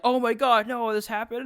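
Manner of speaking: high, loud wail of fake despair; mock upset fan. Fans wailing at the game master over a shocking twist, high mock despair. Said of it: "upset, like".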